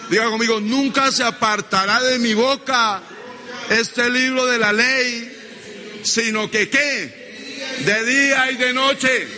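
high, full-force pulpit declaration; insistent, drawn-out preacher's phrases; preaching call-and-response. Real, not acted. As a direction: lead the congregation in a bold vow. Preacher leading congregation in declarations.

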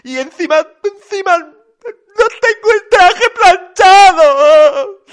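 very high, loud bawling. A narrator's reading. Papá Noel without his suit ironed, bawling loudly. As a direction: drag the last words out into a hammed-up sob. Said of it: "Su llanto se escuchaba con más fuerza".

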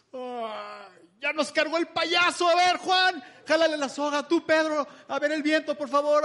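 high, loud, laughing panicked orders; a hammed-up flustered skipper barking at the crew; mock frantic Jesus. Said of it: "Jesús no reaccionó así". (parodia de Jesús alterado, gritando y riendo)

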